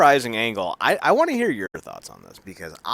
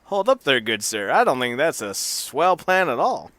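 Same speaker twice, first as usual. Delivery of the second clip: loud, high, prim mock alarm; a scandalized fifties TV dad; hammed-up fifties gent; 4 semitones higher, 4 dB louder than usual. Host acting a prim security guard scolding Ace, hammed-up.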